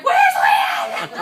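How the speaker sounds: high, loud mock panic, laughing through it; frantic shout. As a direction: shout the question as if the boss is missing. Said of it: "We were like".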